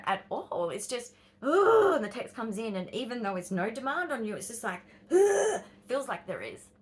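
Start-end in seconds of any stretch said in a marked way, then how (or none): 1.4-2.0 loud, high drawn-out "ugh"; exasperated groan. Retold or imitated. Groaning at a friend's new text, loud and high. 5.1-5.6 loud, high drawn-out "ugh"; exasperated groan. Retold or imitated. Groaning at a friend's new text, loud and high.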